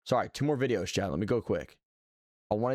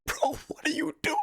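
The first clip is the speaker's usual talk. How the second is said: high-pitched incredulous "bro" complaint; exasperated outcry. Real, not acted. Streamer heckling his own old intro, exasperated. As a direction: squeal it out in exasperated disbelief.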